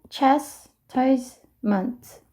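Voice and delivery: chastisement spoken slowly as chas-tise-ment, each syllable separated; split into syllables. Shown as a demonstration. Teacher shows learners how to practise a long word, slow and syllable-split. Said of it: "이렇게 나눠서 연습을 해주시면".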